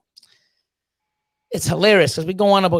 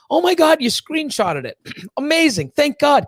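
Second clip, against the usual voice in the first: delighted surprise; 8 semitones higher than usual. Retold or imitated. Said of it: "I'm like".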